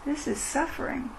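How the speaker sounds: gently name the pain to yourself; quiet, tender voice of noticing; soft self-compassion. Shown as a demonstration. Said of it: "come back to the moment".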